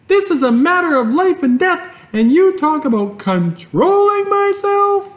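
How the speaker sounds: put-on furious girl's protest; yelling. A narrator's reading. Fern yells at her father, very high and loud. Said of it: "yelled Fern".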